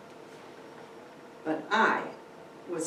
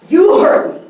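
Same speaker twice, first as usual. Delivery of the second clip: snarled shout; 13 semitones higher, 18 dB louder than usual. Acted. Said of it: "he said, lunging at her".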